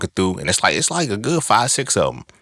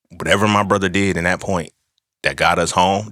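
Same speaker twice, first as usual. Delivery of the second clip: ordinary delivery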